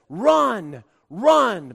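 barked "Fly, fly" commands, loud and high; drill sergeant. Retold or imitated. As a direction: yell it like a drill sergeant on the parade ground. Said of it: "a drill sergeant that ... cuts off your legs and says".

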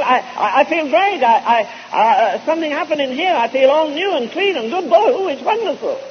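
high, bubbling with joy; thrilled. Retold or imitated. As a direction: gush like someone just reborn. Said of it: "She was so excited. She was so thrilled".

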